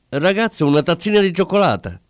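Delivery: high-pitched call to a waiter; calling from afar. A narrator's reading. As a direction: call out your order across the room, lightly projected. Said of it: "gridò ancora di lontano". (richiamo da lontano, acuto)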